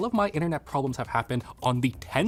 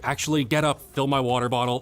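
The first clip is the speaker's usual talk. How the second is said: ordinary delivery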